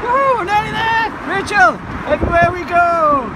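loud, high cheer rolling into a chant; excited whoop. Real, not acted. Cheering on nearing the ground.

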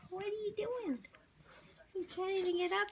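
high soft cooing question; high-pitched pet talk. Real, not acted.

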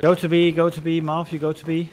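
loud, urgent callout to a teammate; urgent shout. Real, not acted. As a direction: shout the order across the map. Calling teammate to objective.

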